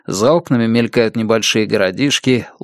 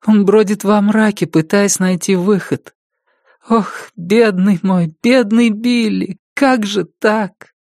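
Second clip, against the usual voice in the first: pitying lament; 9 semitones higher than usual. A narrator's reading. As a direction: let each word sag with grief. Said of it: "думает Элис".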